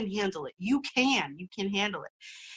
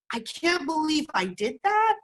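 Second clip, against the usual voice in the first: disbelief; 6 semitones higher than usual. Shown as a demonstration. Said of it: "is limiting".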